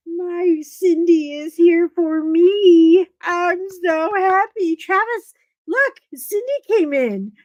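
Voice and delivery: high, excited, fluttery welcome; gushing greeting. Acted. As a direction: welcome her like a dear friend.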